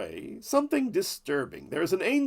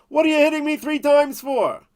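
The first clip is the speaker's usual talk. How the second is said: indignant talking donkey; 10 semitones higher, 7 dB louder than usual. Acted. Donkey speaks to Bilam.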